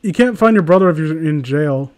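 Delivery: ordinary delivery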